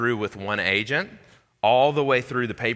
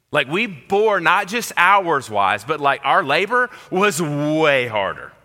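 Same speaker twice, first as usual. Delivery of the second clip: louder, higher and slowed indignant protest; indignant complaint; 7 semitones higher, 6 dB louder than usual. Pretend. Workers complaining about the heat, louder, higher indignation. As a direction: lean on it like a wronged worker.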